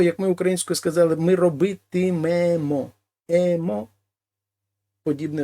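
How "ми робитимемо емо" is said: робитимемо said slowly, ending емо drawn out; slow ending demo. Shown as a demonstration. Teacher showing students the емо ending, slow and deliberate. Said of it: "Закінчення подібне до того".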